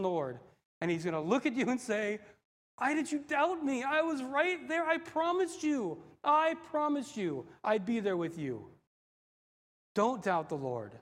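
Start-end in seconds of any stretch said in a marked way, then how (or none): 2.8-8.7 earnest reproach. Acted. Risen Lord to a doubter, earnest reproach. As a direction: plead with the one who doubted, slowing on the promise.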